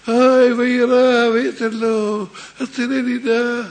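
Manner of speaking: loud, high, slow plea for rescue; crying out. Acted. Jacob cries out to God for rescue, loud slow high plea. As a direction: cry out to God to be saved. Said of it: "צועק".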